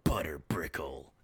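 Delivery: deep voice, a grand reveal; low mystic reveal. Retold or imitated. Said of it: "he starts sniffing the air".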